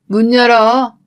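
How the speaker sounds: loud, commanding call through a door; acted, imperious shout. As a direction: call out to be let in. Mother-in-law calls from outside door.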